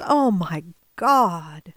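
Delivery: low, slow and drawn-out oh my God; slow dismayed exclamation. Retold or imitated. Doctor listening to the patient's lungs, slow dismay. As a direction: dread settling in as he listens.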